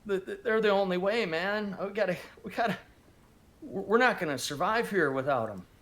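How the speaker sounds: high, stammering, panicked pleading; a cornered man about to cry, voice jumping up; terrified, near tears. Acted. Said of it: "you start to see tears in his eyes".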